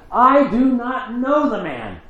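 very high, slow desperate cry of denial; cursing denial. Acted. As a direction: curse and swear it out in panic. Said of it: "Peter began to curse and swore an oath".

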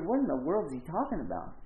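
ordinary delivery

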